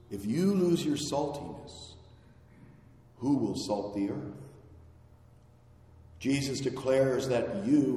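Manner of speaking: ordinary delivery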